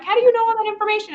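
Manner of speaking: high, fast, incredulous question; puzzled disbelief. Retold or imitated. Reader questioning how Dorian knows so much, incredulous. Acting out her puzzled reaction.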